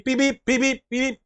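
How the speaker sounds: car horn beeps. Retold or imitated.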